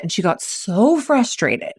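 normal talking voice

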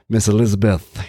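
a hint of a raspy wrestler promo; lightly voiced menacing wrestler; scary Jake the Snake voice. Podcaster recalling a wrestler's promo, lightly voiced scary wrestler. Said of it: "scary Jake the Snake voice".

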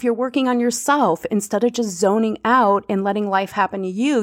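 normal talking voice

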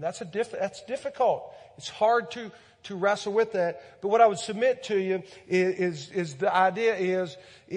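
loud, high preaching push; rising fervent sermon voice; impassioned preaching. Acted. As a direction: drive it home from the pulpit. Preaching at full force.

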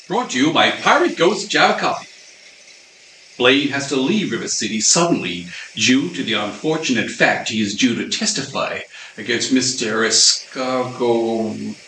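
usual speaking voice

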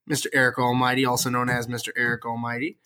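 grand self-introduction. Acted.

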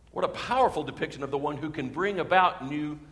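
normal talking voice

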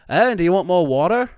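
put-on Australian with a hard R in water; slipping Australian accent. Guest imitating the server offering water, Australian with American R. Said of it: "certain words she wouldn't say".

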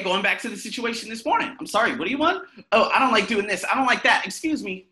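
ordinary talk

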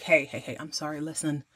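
smooth it over gently; soft apology. Retold or imitated. Blake softly apologizing to his son after snapping. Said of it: "He's like".

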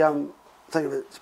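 ordinary talk

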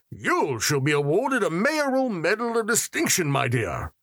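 grandly award the medal; smiling mayor. A narrator's reading. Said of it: "he smiled and added".

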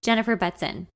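usual speaking voice

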